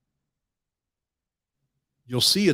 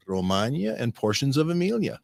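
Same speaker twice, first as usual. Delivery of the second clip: normal talking voice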